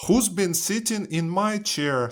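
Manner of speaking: high-pitched mother bear. A narrator's reading. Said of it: "Mother Bear looks at her chair".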